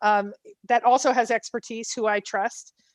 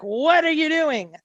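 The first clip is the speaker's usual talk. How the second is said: stern challenge; 6 semitones higher, 4 dB louder, slower than usual. Retold or imitated. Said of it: "like, not yelling".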